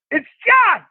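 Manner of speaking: very high, drawn-out mock holler; John Pinette phone holler. Comedian phoning the comic's dad, high mock holler. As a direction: call out a name in a piercingly high voice. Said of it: "And John goes".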